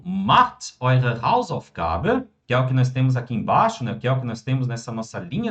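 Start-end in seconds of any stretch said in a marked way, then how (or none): 0.0-2.3 very slow, every German word laid out clearly; slow model sentence. Shown as a demonstration. Teacher modelling German for students, very slow and clear. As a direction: spell the sentence out slowly for the class. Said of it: "quando eu digo".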